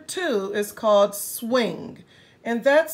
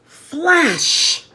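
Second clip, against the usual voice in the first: loud, punchy sound word; dramatic flash; 3 semitones higher, 9 dB louder than usual. Acted. Reader calling out the lightning, loud.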